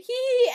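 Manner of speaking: loud high "Hee" mock laugh; imitating own laugh. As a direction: squeak out a silly high "Hee". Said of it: "I went, like".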